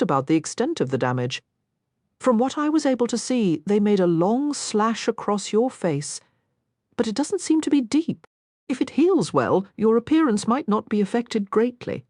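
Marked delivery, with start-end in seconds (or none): 2.2-12.0 prim, earnest lady voice; a proper young miss reassuring him. Acted. Narrator voices Sarah.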